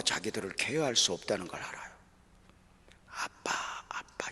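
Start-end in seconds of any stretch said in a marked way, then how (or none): 3.1-4.3 put-on soft slow whisper of children calling dad; imitating crying children. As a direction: whisper it like small kids crying quietly. (아빠를 부르며 우는 아이들을 속삭이듯 흉내)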